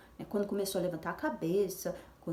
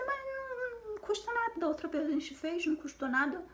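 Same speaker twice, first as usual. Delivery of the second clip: much higher, pleading push-back; insistent retort; 12 semitones higher than usual. Retold or imitated. Wife pushes back to her husband, high insistent voice. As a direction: argue your point up high and eager. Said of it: "Eu falei".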